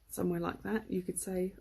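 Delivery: usual speaking voice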